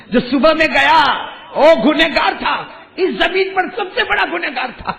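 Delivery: high, loud disbelieving outcry; shouting his complaint up to God; outraged protest. Acted. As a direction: cry out against the injustice of it.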